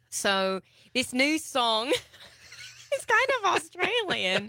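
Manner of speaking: hammed-up Australian accent while laughing. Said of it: "this is totally an Australian accent".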